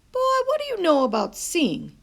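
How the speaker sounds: mildly stern grandma; grandmother voice. A narrator's reading. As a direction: set the boy straight gently. Nana gently scolds.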